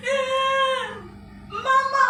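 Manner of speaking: put-on high, small child calling Mama; crying child. Weeping girl calling her mother, high-pitched. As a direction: call out to Mama like a tearful little girl.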